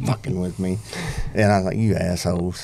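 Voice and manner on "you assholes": softer, rueful grumble; muttered insult. Retold or imitated. A rider grumbling at pranking friends, soft and rueful. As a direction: mutter it, beaten and amused.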